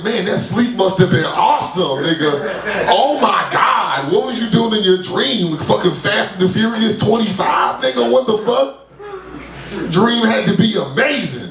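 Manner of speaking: high, excited amazement; jealous of people who died in their sleep; envious wonder. Retold or imitated. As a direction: gush about the dream, awed. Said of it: "I'm like".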